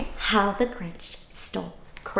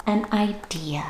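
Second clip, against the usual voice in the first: whisper; 6 dB quieter than usual. Acted. Suspense whisper.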